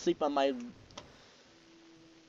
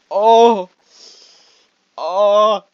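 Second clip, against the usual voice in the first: loud startled oh; 7 semitones higher, 15 dB louder than usual. Real, not acted. Startled game moment.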